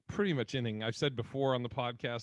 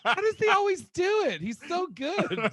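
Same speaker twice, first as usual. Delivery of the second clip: amazed mom voice; 6 dB louder than usual. Retold or imitated. Voicing mom's amazement.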